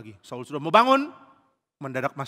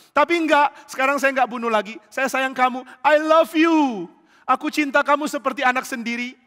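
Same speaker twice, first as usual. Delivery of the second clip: high, loud, gushing protestation; hammed-up sugary declaration; feigned affection; 10 semitones higher, 8 dB louder than usual. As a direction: profess love far too much. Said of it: "Mulutnya begitu pura-pura".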